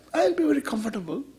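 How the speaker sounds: quick, soft, self-satisfied reply; imitating a listener. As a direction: toss it off lightly as the complacent buyer. Teacher voices a listener's answer.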